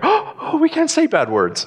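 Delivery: high squeaky mock-scandalised voice; a mock gasp of horror at a bad word; mock prudish shock. Mocking prudish listeners.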